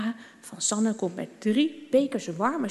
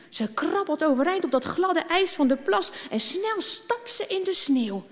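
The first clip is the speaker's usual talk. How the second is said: usual speaking voice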